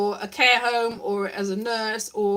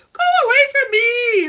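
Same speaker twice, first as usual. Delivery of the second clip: squeaky go-away voice; 11 semitones higher, 7 dB louder than usual. Pretend. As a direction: drag it out in a silly squeak. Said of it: "everyone else will have the same voice".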